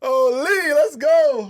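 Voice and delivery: high, loud burst of celebration; triumphant cheer. Real, not acted. Player celebrates getting his phrase past friends, high triumphant cheer.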